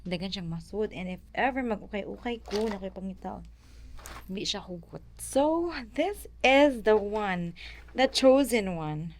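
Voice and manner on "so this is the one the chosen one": slow, loud and higher, like unveiling a treasure; mock grand reveal. Showing off her bag to viewers, mock grand announcement. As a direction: present the bag as if it were legendary.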